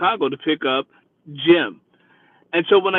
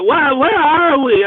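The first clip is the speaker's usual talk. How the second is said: put-on high, slow, groggy drunk voice; a drunk waking up confused; mock drunk, high and dragged out; drunk woman waking; 8 semitones higher, 4 dB louder, slower than usual.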